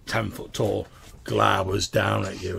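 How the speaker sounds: normal talking voice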